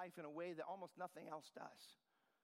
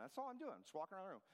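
ordinary delivery

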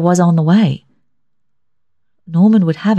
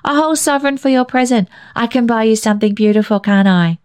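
put-on high, fast eager child voice; a boy rushing with delight; quick, high child chatter; excited little boy; 6 semitones higher, faster than usual. Billy excited about sovereign.

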